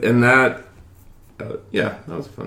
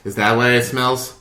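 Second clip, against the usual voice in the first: normal talking voice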